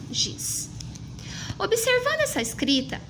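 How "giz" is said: giz whispered very softly; whispered reading. Shown as a demonstration. Teacher imitating how the child read giz, whispered. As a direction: breathe the word giz under your breath. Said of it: "E leu assim".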